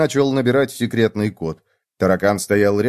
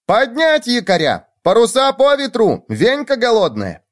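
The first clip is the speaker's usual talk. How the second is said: excited shout; 11 semitones higher than usual. A narrator's reading. Gopher raising the alarm that Venka is hungry, high shout. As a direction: cry it out high like orders on deck. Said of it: "А суслик закричал".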